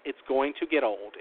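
ordinary delivery

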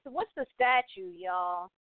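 ordinary talk, nothing marked

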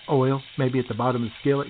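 ordinary delivery

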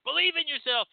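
pep them up like a mock life coach. Flattering client to reader: high, hammed-up coach cheer. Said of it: "become your fucking coaches and be like".

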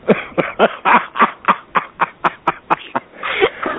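big loud burst of laughter. Real, not acted. Cohost laughs at intro.

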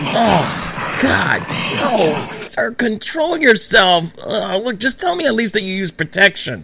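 flustered pleading. Acted.

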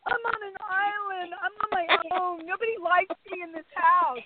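high, plaintive, sorry-for-himself whimper; whiny self-pity. Retold or imitated. Guest mocks James sulking in the diary room, high and whiny. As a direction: imitate a sulking houseguest feeling all alone. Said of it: "he was like".

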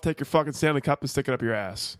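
normal talking voice

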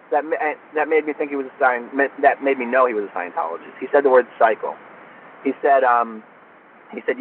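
ordinary talk, nothing marked

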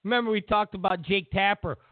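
ordinary talk

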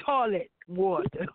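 ordinary talk

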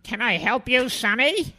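put-on high, breathy whispered old lady asking; imitating a prim old secretary, high and hushed. Old secretary greeting a young visitor, high whispery voice. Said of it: "this old secretary was sitting back there".

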